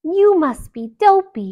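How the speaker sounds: put-on high, loud girlish voice; bright playful guess; princess voice. A narrator's reading. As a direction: princess naming Dopey.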